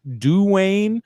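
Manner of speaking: "Dwayne" drawn out as "Doo-wayne"; "Dwayne" with a long oo before wayne; two-syllable Dwayne. Shown as a demonstration. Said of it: "which Dwayne is better".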